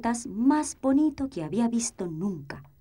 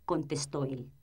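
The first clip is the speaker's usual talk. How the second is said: ordinary delivery, nothing marked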